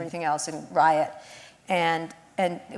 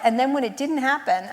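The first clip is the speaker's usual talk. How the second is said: ordinary talk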